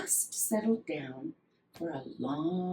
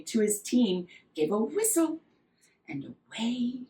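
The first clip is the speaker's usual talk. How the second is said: usual speaking voice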